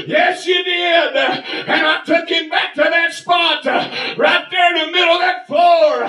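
high, driving revival shout; full-throated pulpit cadence; fervent preaching. Real, not acted. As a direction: cry out the testimony in drawn-out phrases.

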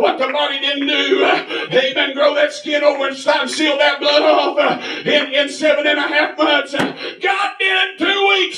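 high revival cadence; impassioned pulpit shout; fervent preaching. Real, not acted. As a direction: proclaim the miracle to the congregation. Preaches at full force.

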